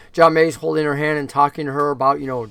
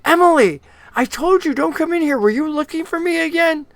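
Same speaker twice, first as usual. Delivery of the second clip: put-on a man's voice lifted much higher, chiding the girl; scolding John May; 11 semitones higher than usual. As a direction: gently tell off a wandering child. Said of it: "He's like".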